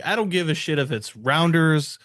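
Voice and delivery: usual speaking voice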